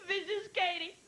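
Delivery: put-on high, tearful little-girl plea; a weepy niece begging her aunt on the phone; crying child. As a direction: sob it out high and slow.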